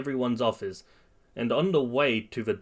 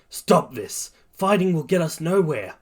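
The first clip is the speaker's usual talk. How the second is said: raised voice, only a little louder; mild yell; 6 semitones higher, 4 dB louder than usual. A narrator's reading. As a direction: call out to stop a fight. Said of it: "Todoroki yells".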